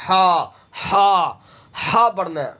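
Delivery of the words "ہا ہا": ہا pushed out from the throat; throaty ha. Shown as a demonstration. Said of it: "ہا حلق سے ادا ہوگا". (ہا حلق سے ادا کریں)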